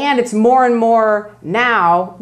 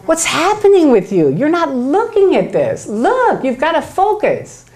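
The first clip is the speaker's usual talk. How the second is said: higher, pushy group nagging; exasperated scolding; 7 semitones higher than usual. Retold or imitated. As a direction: lean on him, impatient.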